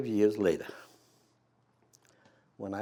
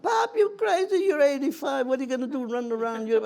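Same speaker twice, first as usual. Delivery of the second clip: high, loud disbelieving voice; incredulous family; 13 semitones higher, 4 dB louder than usual. Retold or imitated. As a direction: scold Pop for going. Family scolding eighty-five-year-old father.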